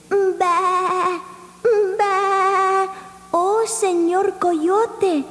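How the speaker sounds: bleating lamb. Acted. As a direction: bleat like a frightened little lamb. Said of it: "Borreguita bleated".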